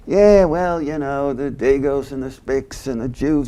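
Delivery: loud blustering retort, voice jumping up; Archie's bigotry. Retold or imitated. Archie brushing off Michael with a loud bigoted retort. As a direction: bluster like a bigoted old man. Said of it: "Archie says something about".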